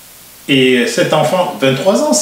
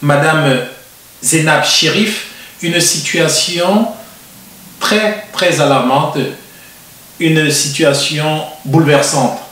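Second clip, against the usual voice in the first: announcer-style opening for the viewers; TV host intro. Acted. Host introducing the show to viewers, in a presenter voice. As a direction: present the show and guest like a broadcaster.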